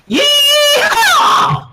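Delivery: very high, loud cowgirl whoop; Applejack yeehaw. A narrator's reading. Applejack celebrating her win, loud high yeehaw shout. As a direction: whoop it to the rafters. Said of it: "Applejack cried".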